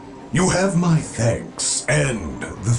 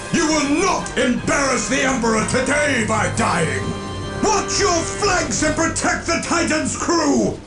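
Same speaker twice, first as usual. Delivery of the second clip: high, forceful commander's yell; rallying cry, pitched high and rising; battle shout; 10 semitones higher than usual. Acted. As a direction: roar orders across a battlefield. Commander rallying troops under attack.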